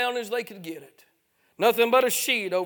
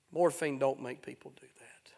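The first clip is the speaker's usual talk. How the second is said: hushed whisper; 7 semitones lower, 8 dB quieter than usual. Acted. Preacher's hushed retort to nurse.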